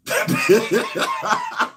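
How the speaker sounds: high-pitched burst of laughter. Real, not acted. Host cracking up at the joke about Deion, high laugh.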